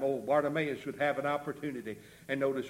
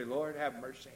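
understated plea; 5 dB quieter than usual. Acted. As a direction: say the prayer quietly, almost in passing. First, understated take of prayer.

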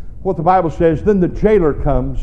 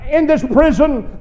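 ordinary talk, nothing marked

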